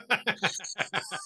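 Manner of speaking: high, soft chuckle; rapid little run of giggles; amused laugh. Real, not acted. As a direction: laugh softly at your own joke. Laughing after own punchline.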